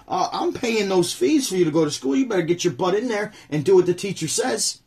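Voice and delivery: a hint of a stern parent scolding the kid; lightly voiced scolding parent; strict parent scolding. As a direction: parent lecturing a child about school fees. Voicing strict Kenyan parent.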